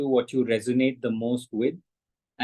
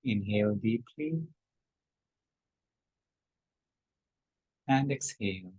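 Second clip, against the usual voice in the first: guided breathing; 7 dB quieter than usual. Shown as a demonstration. Teacher guiding the group's breath, softly. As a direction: gently lead the breath. Breathing guidance, ending meditation.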